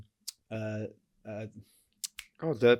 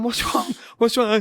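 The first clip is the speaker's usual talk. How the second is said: a hint of a worried partner, higher and louder; 9 semitones higher, 7 dB louder than usual. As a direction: voice her alarmed question. Ex-partner voiced on phone.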